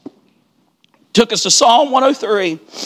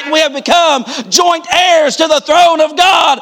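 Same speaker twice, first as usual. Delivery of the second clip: rising into a shouted, climactic preach; preaching at full force; 6 semitones higher than usual. Acted. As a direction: revival preacher climbing to a shout.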